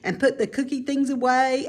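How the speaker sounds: plain reading voice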